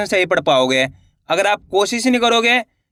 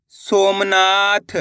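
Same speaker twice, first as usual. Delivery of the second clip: slow spelling; much slower than usual. Shown as a demonstration. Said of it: "अपन एक एक करके शब्दों को पढ़ेंगे".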